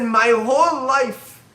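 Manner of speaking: loud, high and very slow, with great intensity; a martyr's fervent, slow declaration; impassioned Rabbi Akiva. Acted. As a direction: draw out every word like a final testament. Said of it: "He said".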